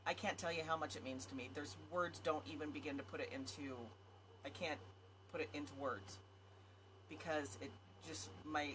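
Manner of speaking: fighting back real tears while thanking them; holding back tears. Real, not acted. Thanking her viewers for their support, softly holding back tears. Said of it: "okay, I'm not gonna cry".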